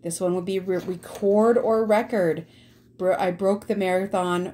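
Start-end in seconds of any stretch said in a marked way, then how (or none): 0.8-2.5 RECORD as re-CORD, then RECORD as REC-ord; homograph both ways. Shown as a demonstration. Teacher showing students both readings, contrasted stress on "record". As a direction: shift the stress in "record or record" from second to first syllable. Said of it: "This is another homograph".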